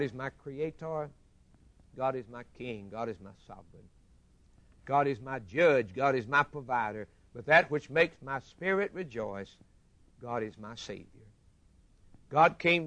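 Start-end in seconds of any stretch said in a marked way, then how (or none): none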